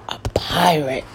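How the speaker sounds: soft dramatic whisper; whispered reveal. A narrator's reading. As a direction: whisper it like a secret. Whispered story ending line.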